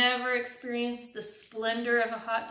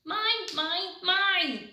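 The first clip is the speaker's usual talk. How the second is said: greedy pigeon; 7 semitones higher, much slower than usual. Acted. Pigeon hoarding hot dog.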